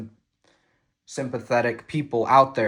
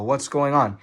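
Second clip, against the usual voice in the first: ordinary talk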